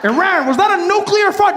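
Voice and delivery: very high, outraged question; hammed-up bully leaping to the worst conclusion; mock alarmed accusation. America accuses Iran of a nuclear fart, mock alarm.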